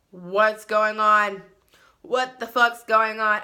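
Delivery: retold alarmed outburst. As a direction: stretch it out like you can't believe it. Retelling alarm at stoned friend.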